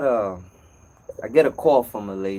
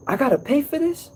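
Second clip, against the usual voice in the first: mock outraged customer, pitched much higher; shocked customer; 13 semitones higher than usual. Customer balking at the service fee, high mock disbelief. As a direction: squeak it out in disbelief at the bill. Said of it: "And she tells me".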